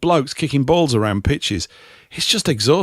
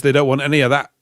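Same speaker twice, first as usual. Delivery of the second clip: normal talking voice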